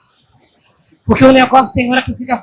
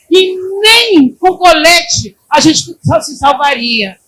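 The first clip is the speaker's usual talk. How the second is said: preaching at full force; 3 semitones higher, 4 dB louder than usual. Acted. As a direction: drive it home to the church. Pregação forte. (pregação exaltada e alta)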